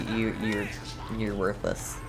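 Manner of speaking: ordinary delivery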